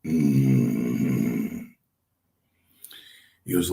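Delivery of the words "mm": very deep, drawn-out groan; long low rumbling "mmm", imitated; Lurch. Veteran hears of rookie's first match, imitated deep groan. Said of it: "like Lurch, hammer went".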